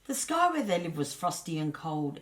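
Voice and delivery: ordinary delivery, nothing marked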